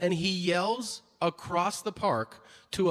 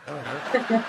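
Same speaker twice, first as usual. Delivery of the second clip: louder, through a laugh, rising at the end; 2 semitones lower, 4 dB louder than usual. Retold or imitated. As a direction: shrug it off mid-laugh. Italian friend laughing off slur.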